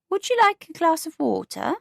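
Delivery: put-on a prim elderly neighbour; prim older neighbour. Neighbour Beatrice voiced.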